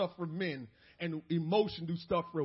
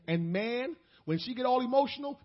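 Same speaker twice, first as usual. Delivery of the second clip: ordinary talk, nothing marked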